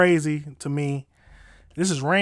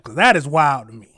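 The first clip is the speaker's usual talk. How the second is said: normal talking voice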